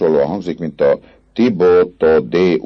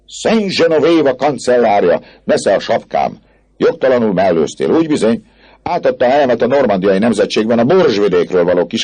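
indignant heckling; 7 semitones higher, 3 dB louder than usual. Acted. Student heckles chancellor with grievance.

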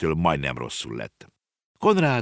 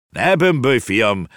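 put-on higher and louder gruff snap at the boy; gruff bark; 6 semitones higher, 5 dB louder, slower than usual. A narrator's reading. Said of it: "recsentett a bácsi".

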